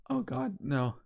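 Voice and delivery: hushed, pleading, afraid; scared whisper. Real, not acted. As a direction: beg quietly under your breath. Dreading a jump scare.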